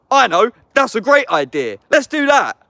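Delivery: mock enthusiasm. Sarcastically acting out someone's decision.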